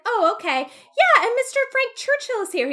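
high, loud mock surprise; hammed-up dismay at hearing Frank is there. Knightley voiced reacting to news.

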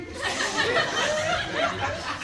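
high, delighted laugh bursting out; burst of laughter. Real, not acted. Preacher laughing as he tells the congregation about the stranger, high delighted laughter.

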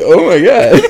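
high, loud, laughing; laughing shriek. Pretend. Co-host imagining waking to a vomiting husband, loud laughing shriek. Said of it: "I'd be like".

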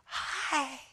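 tiny, high, soft hi; imitating a weak whisper after a trach; imitated trach whisper. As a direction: barely get it out, high and faint. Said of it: "had a whisper 'cause of the trach".

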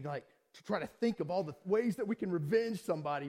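normal talking voice

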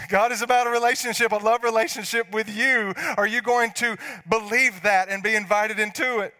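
high, loud, impassioned preaching; full-force preaching. Real, not acted. Pastor proclaiming God's overflowing love, full-force preaching. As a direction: preach it out with fire.

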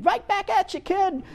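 high-pitched mock comeback; childish tit-for-tat retort, pitched high. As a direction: throw it back at the kid in a high voice. Imagined retort to screaming child.

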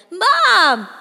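loud high scream of Mom; panicked scream for her mother. A narrator's reading. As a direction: scream it past your father. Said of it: "I scream past him".

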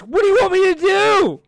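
put-on squeaky high panicked girlfriend voice; frantic girlfriend squeal; high mock girlfriend. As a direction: play her frantic, way up high. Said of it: "She's like".

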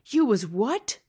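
loud, high, shocked question; astonished cry. A narrator's reading. B F G hears Sophie was in his mouth, astonished. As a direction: cry it out in disbelief. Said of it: "cried the B F G".

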